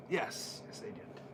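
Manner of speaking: soft breathy gloat; gloating whisper. Pretend. Voiced Roddy gloats to himself, whispered. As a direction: hiss it to yourself in triumph.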